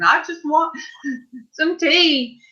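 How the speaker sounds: slow, high, teary voice through a laugh; mock her own crying, half laughing; teary laughing plea. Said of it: "I still bust out crying again".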